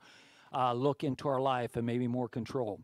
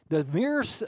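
loud, rising preacher's cry; preaching at full force; 6 semitones higher, 8 dB louder, slower than usual. Real, not acted. Pastor warning his church about other religions, preaching loud and slow. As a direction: drive it home to the congregation.

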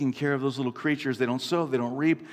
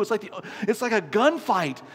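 ordinary talk, nothing marked

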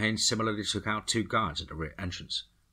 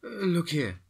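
put-on higher, hesitant Latin-accented woman; Miss Vega; 10 semitones higher than usual. A narrator's reading. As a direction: point it out timidly in a Latin accent. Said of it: "And Miss Vega said".

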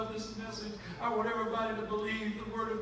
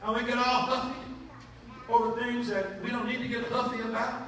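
normal talking voice

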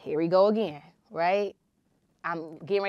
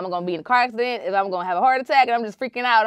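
panicked rush; 5 semitones higher, 10 dB louder, much faster than usual. Retold or imitated.

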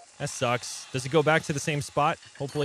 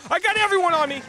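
much higher, loud panicked cry; panicked shout; 6 dB louder, faster than usual. Real, not acted. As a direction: yell it in panic. Repeated while fleeing.

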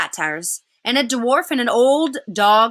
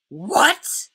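higher-pitched, outraged witch; roaring witch; 6 semitones higher than usual. A narrator's reading. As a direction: burst out in disbelief as the witch. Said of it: "roared the witch".

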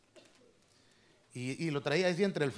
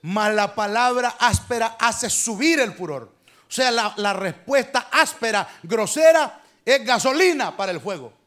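forceful preaching; 3 semitones higher, 8 dB louder than usual. Acted. Preacher warns the church, loud forceful preaching. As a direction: lean into grosera and the fire.

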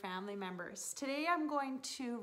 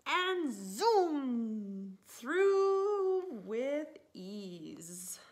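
very slow, louder zoom; drawn-out zoom; 6 dB louder, much slower than usual. Acted. Reading to kids, a slow thrilling zoom. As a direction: stretch the zoom like speed. Sled zooming through.

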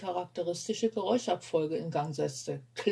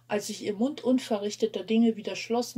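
ordinary delivery

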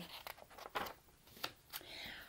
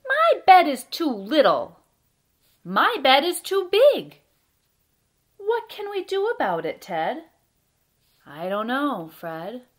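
loud, deliberate picture-book narration with pauses; reading to children; 5 semitones higher, 11 dB louder than usual. A narrator's reading. Storyteller reads a bedtime tale about Fred and Ted, lively read-aloud. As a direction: read it out with big, bouncy emphasis.

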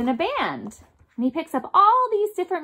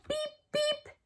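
much higher squeaky beep beep; 13 semitones higher, 11 dB quieter than usual. Shown as a demonstration. Teacher shows kids the taxi horn, high. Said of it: "Can we practice that together".

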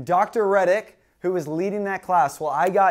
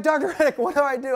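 1 panicked call for help; 9 semitones higher, faster than usual. Retold or imitated. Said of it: "I'm like".

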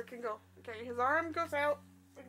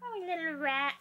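cooing; 6 semitones higher than usual. Real, not acted. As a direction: coo over a tiny pet. Cooing over a toy rat.

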